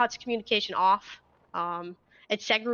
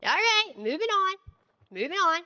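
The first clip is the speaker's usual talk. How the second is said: yelling across the room; 9 semitones higher than usual. Real, not acted. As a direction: project to the back of the room. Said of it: "make sure you're yelling at".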